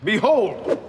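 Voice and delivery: high, loud, drawn-up unveiling cry; grand proclamation. Acted. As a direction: proclaim it like a great revelation. Unveils his pyramid of greatness.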